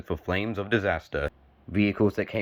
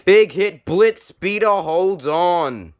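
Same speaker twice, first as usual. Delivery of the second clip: higher and louder, a real thrill at the hit; excited call; 6 semitones higher, 4 dB louder, slower than usual. Real, not acted. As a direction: call the crash like it just stunned you. Big crash during live commentary.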